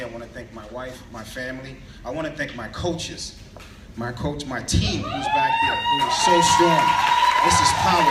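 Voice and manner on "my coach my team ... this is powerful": loud, high, laughing shout-out. Real, not acted. Speaker shouting thanks to his team in back, laughing. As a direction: cheer your team from the stage.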